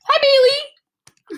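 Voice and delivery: high, slow doting hello; sweet pet voice. Real, not acted. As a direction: coo hello to the dog. Greeting the dog sweetly.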